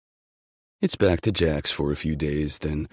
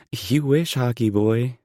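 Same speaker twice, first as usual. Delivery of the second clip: high scoffing laugh, then teasing; 9 semitones higher, 8 dB louder, slower than usual. Acted. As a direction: brush him off with a laugh. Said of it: "She scoffs".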